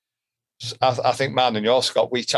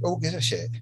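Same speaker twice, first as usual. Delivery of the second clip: soft dismissive aside; 3 semitones higher, 6 dB quieter than usual. Retold or imitated. Self-talk dismissing worry.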